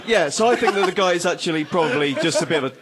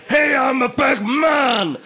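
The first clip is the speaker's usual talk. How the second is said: slow, high blustering shout; big man shout; 8 semitones higher, 4 dB louder, much slower than usual. Retold or imitated. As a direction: puff up and bellow it slowly. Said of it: "a shit Matt impression".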